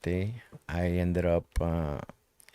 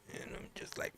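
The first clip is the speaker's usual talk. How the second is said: faint whisper; 18 dB quieter, faster than usual. Retold or imitated. Stopped breathing.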